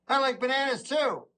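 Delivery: high-pitched, simple delight; cheerful, guileless high voice; happy agreement. Acted. Acted joining in friend's delight.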